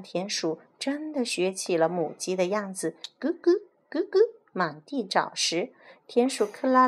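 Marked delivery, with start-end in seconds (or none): 2.7-4.2 high clucking like a hen; high imitated hen clucks. Rats act like hens.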